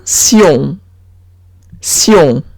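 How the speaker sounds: "sion" with an s and a nasal on; French -tion. Shown as a demonstration. Teacher showing how T I O N sounds, slow syon. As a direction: say "sion" slowly as syon, n not closed. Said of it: "Como si la T fuese una S".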